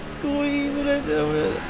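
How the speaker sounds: high and very slowly stretched out; slow strained drawl. Real, not acted. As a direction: drag the words out through the corner. Tense moment in a corner.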